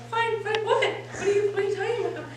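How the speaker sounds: high, unforced, puzzled questioning; stammered high-voiced bewilderment; confused questioning. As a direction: ask it high without shouting. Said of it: "I didn't say it like this".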